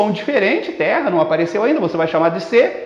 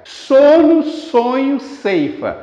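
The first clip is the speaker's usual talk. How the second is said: "Sono, sonho, ceifa" word by word, S onsets stressed, falling away; stressing repeated S; 4 semitones higher, much slower than usual. Shown as a demonstration. Teacher isolating alliteration, each S word stressed separately. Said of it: "pela repetição do som consonantal S".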